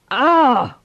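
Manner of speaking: loud startled cry; sharp yell of shock; shout. Acted. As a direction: bark it out as cold water hits. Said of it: "Mark shouted".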